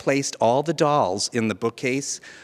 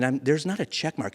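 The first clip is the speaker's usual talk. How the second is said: ordinary talk, nothing marked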